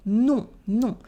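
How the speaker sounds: "non" with nasal oh, no final N; nasal vowel. Shown as a demonstration. Teacher demonstrating nasal sounds, "non" on rounded nasal oh. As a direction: send the vowel of "non" through the nose. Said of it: "the on in non".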